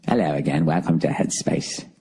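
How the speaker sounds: soothing meditation guide. Retold or imitated. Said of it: "soothing voice like this, some sort of British accent".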